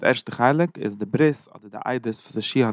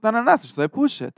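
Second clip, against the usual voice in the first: mock-outraged no, pitched up high; indignant refusal; 10 semitones higher than usual. The people answering Yehoshua, in mock outrage. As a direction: throw it back as if insulted.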